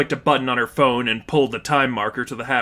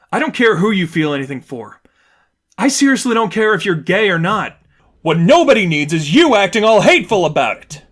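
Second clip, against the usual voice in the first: loud and higher, building to a yell; heated; 6 semitones higher, 7 dB louder than usual. A narrator's reading. Stephanie rebuking her friend, heated and rising. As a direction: hammer the point home.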